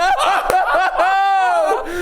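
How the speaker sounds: high shrieking burst of laughter; a loud, high, delighted shriek of laughter. Real, not acted. Shocked laugh at reveal.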